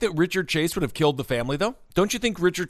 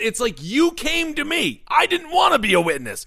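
high-pitched comic pleading; exasperated witness; 10 semitones higher than usual. Acted. Witness begging the killer to go, high and exasperated. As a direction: protest high, dragging out the final plea.

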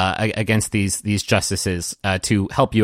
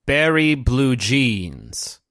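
slow "Barry Blue Jeans", leaning on the first syllable; stress on first syllable; 2 semitones higher, much slower than usual. Acted. Statue solemnly names the accused, "Barry Blue Jeans" slowly stressed on its first syllable. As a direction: draw out "Barry Blue Jeans" with the accent up front. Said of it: "Never heard it with the accent on the first syllable".

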